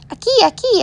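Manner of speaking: high urgent call in Spanish. Acted. Grandfather calls the ambulance men over, high urgent call. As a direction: wave them over, calling high. Pappy calling the medics.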